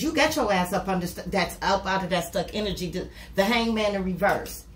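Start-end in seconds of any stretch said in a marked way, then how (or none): none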